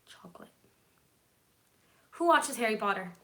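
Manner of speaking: ordinary delivery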